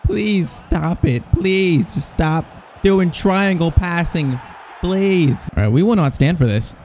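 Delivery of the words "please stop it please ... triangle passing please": slow, drawn-out begging; pleading. Real, not acted. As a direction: stretch each please in exasperated appeal. Begging opponents to stop passing.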